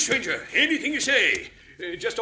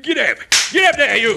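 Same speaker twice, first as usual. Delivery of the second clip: loud, higher driving call; calling to horses; 4 semitones higher, 7 dB louder than usual. Acted. Wagon driver whipping up the horses, calling out loudly. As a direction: urge the team on with a shout.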